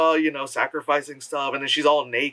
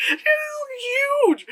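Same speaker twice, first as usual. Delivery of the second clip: amazed; slower than usual. Real, not acted. Amazed at huge heart.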